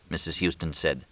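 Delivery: ordinary delivery, nothing marked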